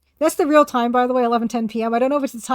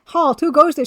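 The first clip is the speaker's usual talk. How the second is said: loud, high "Halt! Who goes there?"; guard's shout; 3 semitones higher, 7 dB louder than usual. Acted. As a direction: bark it like a gate guard. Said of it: "shouts Noxious".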